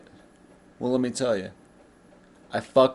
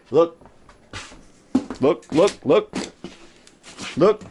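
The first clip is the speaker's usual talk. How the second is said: eager look; 6 semitones higher, slower than usual. Real, not acted. Urging viewers to look at the wheels, eagerly rising. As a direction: point at it and make them look.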